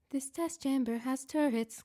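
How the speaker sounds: measured computer voice, a bit slow; slow cold AI voice. Acted. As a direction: announce it like a detached test AI. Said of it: "GLaDOS said".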